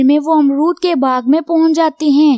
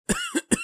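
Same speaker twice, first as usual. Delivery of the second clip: a short hacking cough; sick crow's cough; 13 dB quieter than usual. Acted. Sick crow boy at night, a short hacking cough. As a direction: cough like a child with a sore throat. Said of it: "उससे बहुत ज्यादा खांसी आ रही थी".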